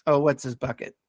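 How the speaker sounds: usual speaking voice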